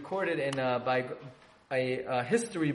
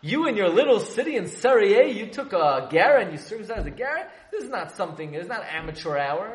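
upset rebuke; 4 semitones higher, 6 dB louder than usual. Retold or imitated. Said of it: "Chassam Sofer says".

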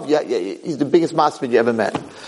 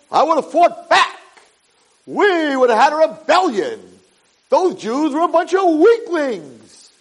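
high, impassioned mock outburst; high-pitched scoffing bravado; indignant boasting; 12 semitones higher than usual. Acting out cocky kid's boast.

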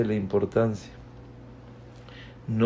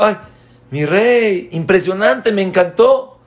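high, loud, gushing praise; over-the-top delighted parent; 9 semitones higher, 7 dB louder than usual. Acted. Parent reacting to child arriving.

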